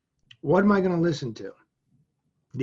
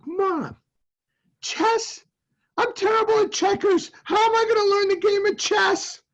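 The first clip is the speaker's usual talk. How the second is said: put-on much higher, whining little boy voice; whiny kid; 3 dB louder than usual. Boy whining to his mom about learning chess, high and pleading. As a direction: squeak it out like a despairing twelve-year-old.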